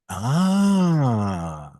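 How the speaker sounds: long, loud "ah" of dawning understanding; long, swelling "aah"; drawn-out realization "ah". Retold or imitated. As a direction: re-enact the penny dropping with a stretched "aah". Acts out moment of understanding.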